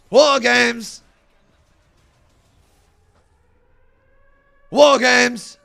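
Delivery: loud, high, drawn-out ring announcer call; building hype chant. Acted. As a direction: boom it out like an arena announcer. Hyping the event like a ring announcer.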